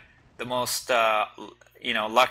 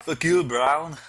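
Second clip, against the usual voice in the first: slightly raised mock-insult voice; lightly put-on voice, higher than usual; Finale; 4 semitones higher, 4 dB louder, slower than usual. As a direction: impersonate a fellow player's taunt. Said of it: "This is Finale".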